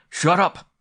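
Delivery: very loud, high shout. A narrator's reading. Sturgis shouts down the visitor, full yell. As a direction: cut him off with a yell. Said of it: "Sturgis shouted".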